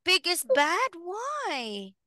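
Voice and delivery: Pig is bad, pitched high in playful disbelief; surprised. Real, not acted. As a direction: act amazed the pig could be bad. Teacher surprised at child's claim.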